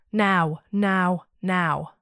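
loud, urgent calls of now. A narrator's reading. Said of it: "even louder this time".

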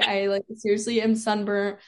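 normal talking voice

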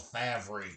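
Favre slowly read as "FAV-ree"; spelling pronunciation. Shown as a demonstration. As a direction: sound out Favre in two syllables, "FAV-ree". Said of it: "It's spelled Favre".

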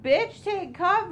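high, slow shouted warning; mock yell. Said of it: "And Allison's like".

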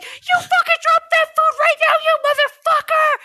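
high-pitched yell at a fleeing thief; chasing yell. Retold or imitated. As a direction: scream it up high while chasing him down. Yelling after thief while chasing.

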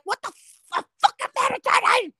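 put-on annoyed parent interrupted while meditating yells at the kid; mock outraged yell. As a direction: snap at the kid at the door, hammed up. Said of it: "I'm like".

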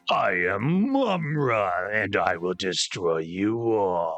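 slow, deep-toned villain boom; Mumra voice. Retold or imitated. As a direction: intone it like an ancient evil mummy. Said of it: "Mumra's like".

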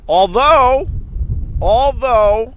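high, loud stretched although; high drawn-out although. Real, not acted. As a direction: call it out high and long.

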